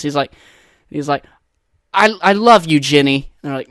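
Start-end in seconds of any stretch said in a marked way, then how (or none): 1.9-3.3 loud, overeager declaration in own voice; hammy, failed movie impression; bad actor's audition line. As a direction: overact it without any character voice. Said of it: "you didn't make the Forrest Gump voice at all".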